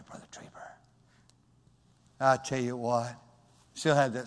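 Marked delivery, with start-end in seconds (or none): 0.0-0.7 quiet. Retold or imitated. Replying softly to voiceless friend.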